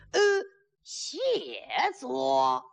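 halting, drawn-out formal reply; stiff courtly thanks. Acted. Groom thanking bride for the seat, halting stiff courtesy. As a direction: stretch out each word of thanks.

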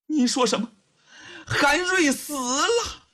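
put-on stunned high-pitched young woman; acted shock at terrible news. A narrator's reading. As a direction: drag out the disbelief, rising high. Hearing news of a death.

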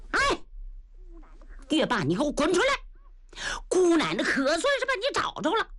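high, laughing challenge yelled at the door; girl calling out. Acted. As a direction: call him out with a triumphant laugh. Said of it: "突然外头一喊". (姑娘得意地笑着喊人出来)

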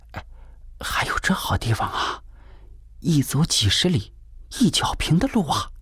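breathy, secretive stage whisper of delight; excited hushed whisper. A narrator's reading. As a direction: whisper it, thrilled but afraid of being overheard. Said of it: "悄声说". (激动地悄声说)

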